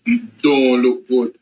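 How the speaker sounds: ominous slow. Acted. Repeated slower.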